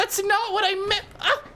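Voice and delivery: protest, flustered and squeaking; exasperated squeal. Real, not acted. Co-host squeals a flustered denial about the innuendo.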